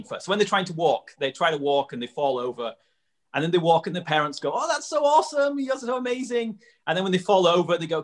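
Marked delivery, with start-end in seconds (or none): 4.5-6.6 high-pitched, gushing praise in an imitated parent voice; gushing encouragement. Parent praising a toddler's first steps, imitated gushing encouragement. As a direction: imitate a parent cooing over a toddler's achievement. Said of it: "the parents are encouraging them".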